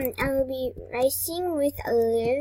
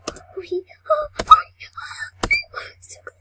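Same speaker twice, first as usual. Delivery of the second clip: breathy frightened giggle under the breath; nervous whispered laugh; 6 dB louder than usual. Real, not acted. Scared player laughing nervously in a whisper.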